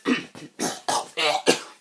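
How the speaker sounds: harsh choking cough; coughing fit. Real, not acted. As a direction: cough hard mid-sentence. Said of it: "sorry, I'm choking here".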